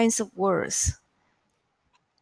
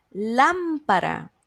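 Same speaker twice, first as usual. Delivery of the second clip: "lámpara" with a long, raised LÁM; stress on LÁM; slower than usual. Shown as a demonstration. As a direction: lean on the first syllable of "lámpara". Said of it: "¿Cuál es la sílaba tónica?".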